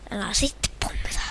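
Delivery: softly whispered plea. Real, not acted. Gamer pleading at the shooter, whispered. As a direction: breathe the warning. Warning shooter under his breath.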